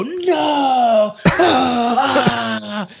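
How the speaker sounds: high pained "no" groaning out; anguished groan. Retold or imitated. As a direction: groan like a fan whose team just lost the ball. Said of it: "get you to go".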